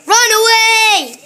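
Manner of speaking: loud, high, drawn-out scream; panicked shout. A narrator's reading. Policeman sees the scary face and flees, panicked shout. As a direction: burst into a long terrified yell.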